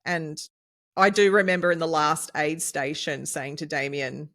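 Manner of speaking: normal talking voice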